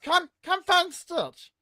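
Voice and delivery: very high, slow creepy character voice; Stitch character voice. Retold or imitated. A haunt character luring little kids in. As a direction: coax the kids in like a spooky doll. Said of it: "and I go".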